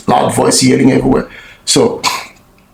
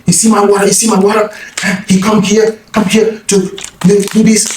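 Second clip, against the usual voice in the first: much higher mock yell to onlookers; very high hammed-up accusing call; demonstrating his yelling; 9 semitones higher than usual. Shown as a demonstration. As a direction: shout it out to the crowd, pointing.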